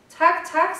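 high, loud grateful cry; revived emperor thanking the nightingale, high and loud; overjoyed thanks. Acted. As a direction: burst out in thanks. Emperor's joyful gratitude.

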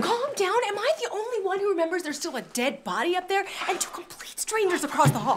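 high-pitched, frantic protest; panicked outburst. Acted. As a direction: let the voice climb in alarm and disbelief. Panicked protest about dead body.

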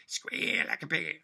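high, loud hillbilly film-villain voice; Deliverance villain. Retold or imitated. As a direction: bark it out like the movie villain. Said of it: "is based on the deliverance".

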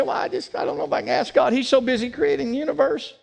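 hushed, unsure voice of a timid believer; timid doubter. Retold or imitated. A weak believer hesitates to ask God, hushed. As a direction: shrink back, almost whispering the doubt. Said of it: "maybe you're one of these weak people and say".

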